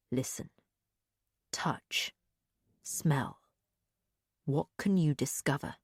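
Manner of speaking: very soft, low guiding voice; hushed single words, spaced out and reassuring; calm. A narrator's reading. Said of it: "his voice was so calm in my mind".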